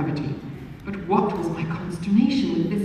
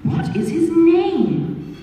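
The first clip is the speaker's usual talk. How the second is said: slow, higher prying question; pressing question; 9 semitones higher, 3 dB louder, much slower than usual. A narrator's reading. Stranger pressing the young lady for a name, slow. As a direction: drag the question out to tease her.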